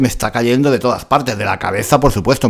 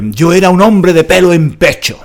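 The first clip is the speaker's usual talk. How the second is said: loud, higher proud boast; 6 semitones higher, 6 dB louder than usual. Acted. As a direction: puff up like a macho man.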